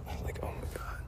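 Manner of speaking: breathy whispered aside. Retold or imitated. Reacting to the farmer's question, in a whisper. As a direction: whisper it to yourself in dismay.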